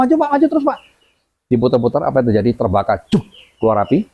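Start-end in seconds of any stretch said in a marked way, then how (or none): 0.0-0.8 high-pitched, excited urging; eager urging. Retold or imitated. Mother urging father again.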